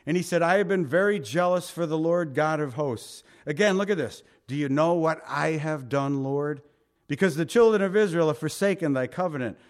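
preacher reading Elijah's complaint from the Bible; scripture reading. Acted. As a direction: read it out with weight.

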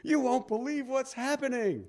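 high-pitched imitation of a worked-up woman; mock-frantic, high phone-caller quote. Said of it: "she's crying and laughing and all this".

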